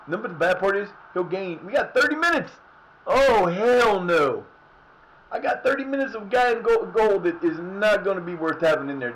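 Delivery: high, loud dismayed rant; exasperated rant. Real, not acted. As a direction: complain loudly about the goalkeeper. Dismayed at a weak goalkeeper.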